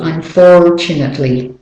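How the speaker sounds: American "Unfortunately" with the R sounded before T; American accent. Shown as a demonstration. Teacher shows learners the R, American-accented "Unfortunately". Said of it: "need that to say the R".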